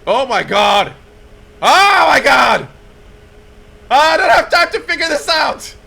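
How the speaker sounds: loud, high panicked shouting; rising panic. Real, not acted. Pilot with no time before landing, escalating panicked shout. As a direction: build from alarm to a frantic yell. Plane suddenly hurtling in, no time.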